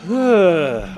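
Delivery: long heavy exhale; catching-breath sigh; real sigh. Real, not acted. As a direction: let out a winded sigh. Winded player catches his breath.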